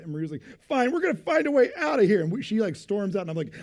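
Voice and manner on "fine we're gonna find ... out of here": much higher and louder, mock indignant; huffy exit. Wife storming away from the gate agent, mock huffy. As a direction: storm off with a parting shot.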